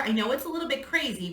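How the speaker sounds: usual speaking voice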